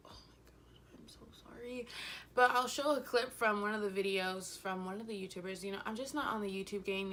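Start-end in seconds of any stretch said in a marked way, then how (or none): 0.1-1.9 breathless whispered "Oh my God, I'm so sorry"; whispered apology. Retold or imitated. After tripping onto her friend, whispered apology. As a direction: whisper it, embarrassed.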